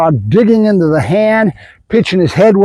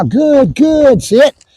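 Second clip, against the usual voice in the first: dog praise voice; 6 semitones higher, much slower than usual. Real, not acted. Praising dog mid-explanation.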